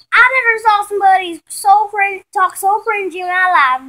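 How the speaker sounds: high, loud mocking disbelief; lightly hammed big-sister scorn; scornful tease. Vanessa mocking her sister's cringy talk, high and loud. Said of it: "then she said".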